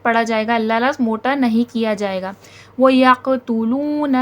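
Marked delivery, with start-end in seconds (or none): 2.8-4.2 slow chanted reading with a stretched waw; measured tajweed chant; Quranic recitation. Shown as a demonstration. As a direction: recite it carefully, lengthening the long vowel. Teacher recites the verse.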